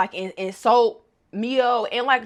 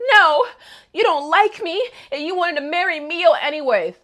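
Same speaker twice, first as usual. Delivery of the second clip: louder, higher whine; high whiny protest; 6 semitones higher, 5 dB louder than usual. Retold or imitated. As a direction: whine it back. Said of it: "Kaya just like".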